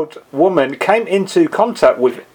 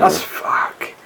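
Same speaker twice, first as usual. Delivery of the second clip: whispering; 13 semitones lower, 5 dB quieter than usual. Real, not acted. Whispered aside after the story.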